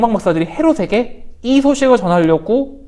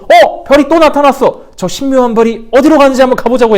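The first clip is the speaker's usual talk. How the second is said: excited magi; 6 semitones higher, 8 dB louder than usual. Acted. Magi spot the star again.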